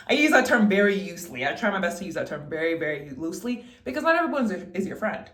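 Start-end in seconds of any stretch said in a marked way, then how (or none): none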